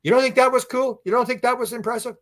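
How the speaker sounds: high mock-taunting voice; taunting aliens. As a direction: tease like a showing-off spirit. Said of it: "So the intelligence says".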